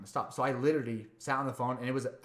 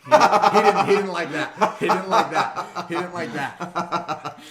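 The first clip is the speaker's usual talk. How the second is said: laughing; 4 semitones higher, 12 dB louder, much slower than usual. Real, not acted. Laughing at the caller's reaction.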